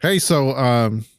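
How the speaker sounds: usual speaking voice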